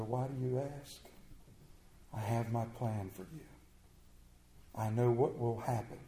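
low, measured reply, lightly voiced; a hint of God's voice, pitched low; God answering. God answers someone questioning his plan, low and measured.

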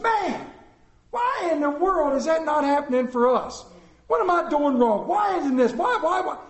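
high, loud, frustrated pleading; retold fit of frustrated whining; exasperated lament. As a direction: wail it in exasperation, hammed-up. Preacher replays his own frustrated lament.

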